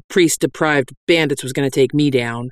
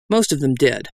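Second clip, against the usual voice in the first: usual speaking voice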